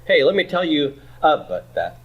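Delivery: "about" wrongly squashed to "UH-b't"; reduced stressed vowel. Shown as a demonstration. Teacher joking about what you never say, "about" with its stressed vowel reduced to "uh-but". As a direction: swallow the second vowel of "about", leaving "uh-but". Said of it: "you never reduce your stressed vowel".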